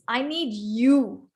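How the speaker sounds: YOU drawn out at the end; slow line landing on YOU; stress on you. Shown as a demonstration. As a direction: hold YOU with a rise and fall. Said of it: "what Saumita is highlighting is".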